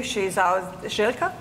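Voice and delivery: ordinary delivery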